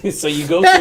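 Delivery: high, laughing words. Real, not acted. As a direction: say it through a laugh. Laughing after the impression.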